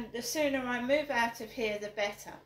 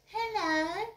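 high sing-song mock hello; sarcastic imitation of a fake friendly hello; mocking put-on hello; 8 semitones higher than usual. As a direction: mimic a posh greeting. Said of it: "And I don't speak like this".

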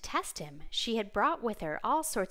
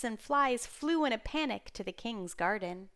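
ordinary delivery, nothing marked

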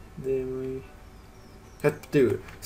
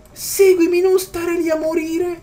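desperate pleading; 13 semitones higher, 4 dB louder, slower than usual. Real, not acted. Begging pet to follow.

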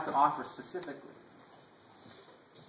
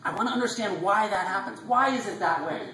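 higher-pitched, insistent student asking why; eager student question; 6 semitones higher than usual. Retold or imitated. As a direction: ask like a curious undergrad in class. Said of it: "raise my hand in class and say".

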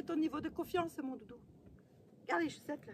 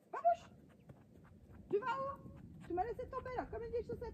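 much higher, whiny mock complaint; high teasing complaint to the horse who walked off; mock hurt; 9 semitones higher than usual. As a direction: play the abandoned friend.

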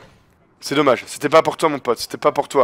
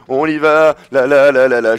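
normal talking voice

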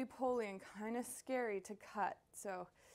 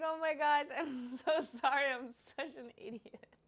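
higher and louder, laughing through sorry; laughing apology; 5 semitones higher, 6 dB louder than usual. Retold or imitated. Cook apologising to annoyed chef, laughing sheepishly. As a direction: giggle out an embarrassed apology. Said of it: "I was like".

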